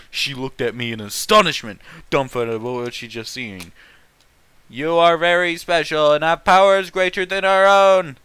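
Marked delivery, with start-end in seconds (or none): 4.7-8.2 louder mock group voice, grandly declared; hammed-up chorus of ninjas. Ninjas praising the hero in chorus, louder mock solemn voice. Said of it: "they said in chorus".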